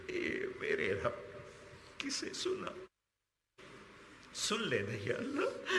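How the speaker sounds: breaking into sobs. Real, not acted. Preacher tells God of waiting children, breaking down in tears. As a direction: voice cracking into real weeping.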